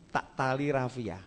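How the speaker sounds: normal talking voice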